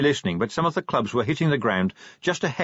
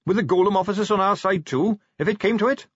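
Carrot's eager voice; 10 semitones higher than usual. Acted. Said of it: "said Carrot".

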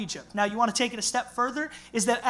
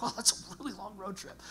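quiet mock wonder; 10 dB quieter than usual. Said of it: "People are like".

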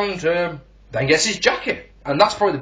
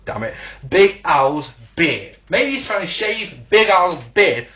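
normal talking voice

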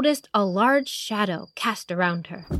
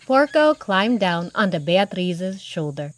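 usual speaking voice

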